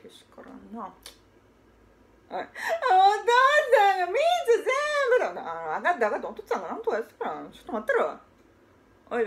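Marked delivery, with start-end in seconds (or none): 2.3-5.4 high, loud, slow tattling wail; boy shouting through tears at his father; crying shout. Acted. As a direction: yell it out like a wronged little kid in tears. Child cries father ate syrup.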